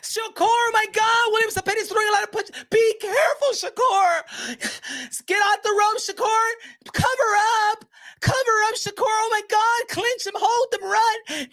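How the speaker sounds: very high, squealing panic; hammed-up fretting spectator in a high squeal; mock panicked fan. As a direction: plead frantically in a falsetto.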